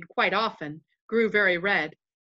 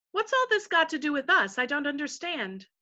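higher-pitched puzzled questioner; a touch of bewilderment, pitched up; lightly voiced puzzled Lucy; 7 semitones higher than usual. Lucy asks the invisible voices, lightly voiced puzzlement.

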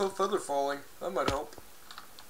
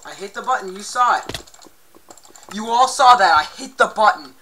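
indignant insisting; 6 semitones higher, 15 dB louder than usual. Real, not acted. Insists to viewers.

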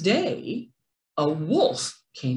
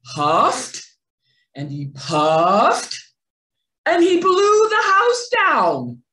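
slow, then rising high and loud on the blow; storyteller swelling to a big high finish; building huff and puff; 8 semitones higher, 6 dB louder than usual. A narrator's reading. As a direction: build it up, then blast the house down. Narrator acts huffing and puffing.

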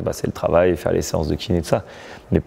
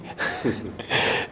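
soft breathy laugh; 2 semitones higher, 8 dB quieter than usual. Real, not acted. Laughing at his own disbelief.

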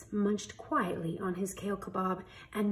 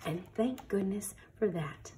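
closing whisper; 2 semitones lower, 8 dB quieter than usual. A narrator's reading. Story ending.

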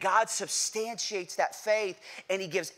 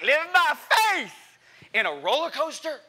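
hammed-up thrill-seeker bravado; daredevil yell; 9 semitones higher, 6 dB louder than usual. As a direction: cry it out like a fearless rider. Acting out cocky insane rider.

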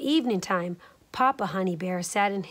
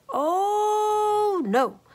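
high sour-faced cry; 11 semitones higher, 3 dB louder than usual. A narrator's reading. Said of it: "Leola cried".

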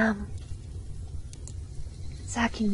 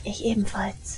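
whispered confession; 3 dB quieter than usual. Acted. Girl admits her own taint.

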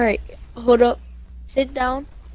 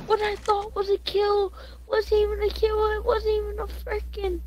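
high frustrated wail; 10 semitones higher, 3 dB quieter than usual. Real, not acted. Kill didn't count.

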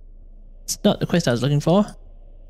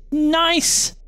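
delighted; 11 semitones higher than usual. Real, not acted. Finds loot.

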